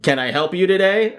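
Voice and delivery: a lightly voiced eager store robot. Robot greeting a customer at Victoria's Secret, lightly voiced. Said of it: "the robot's like".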